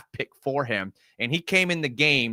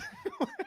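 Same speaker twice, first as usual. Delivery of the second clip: incredulous laugh; 12 dB quieter than usual. Real, not acted. Laughing at own rant.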